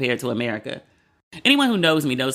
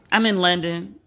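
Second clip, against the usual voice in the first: normal talking voice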